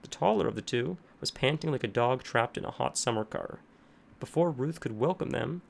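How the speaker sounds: usual speaking voice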